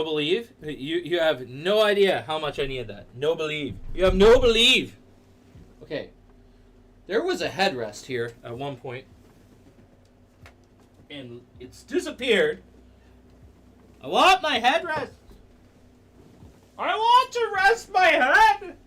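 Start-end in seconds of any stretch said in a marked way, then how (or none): 3.9-5.0 shouted playfully, loud and high; mock loud. Streamer shouting chat's phrase back, mock loud. 14.0-18.8 high, slow whiny whimper. Pretend. Streamer whining to chat about the missing headrest, high and slow.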